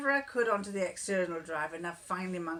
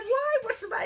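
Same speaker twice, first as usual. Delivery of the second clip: mock innocent; 5 dB louder, faster than usual. Feigning innocence, laughing.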